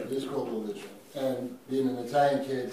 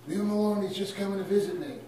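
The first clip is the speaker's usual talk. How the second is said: high-pitched, louder retold plea; imitated screaming aunt; 8 semitones higher, 3 dB louder than usual. Aunt on the third floor defending her visiting nephew, imitated screaming. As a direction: call out like a mock-screaming aunt. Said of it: "My aunt was on the third floor screaming".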